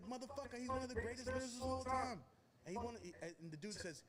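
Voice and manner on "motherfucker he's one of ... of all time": higher, insistent praise; emphatic praise. Retold or imitated. Rapper insists [name] is among the greatest lyricists, higher insistent. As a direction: insist on it, pitched up.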